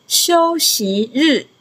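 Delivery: 休息日 in long, separated syllables; slow model pronunciation. Shown as a demonstration. Teacher modelling Chinese for a student to repeat, slow and measured.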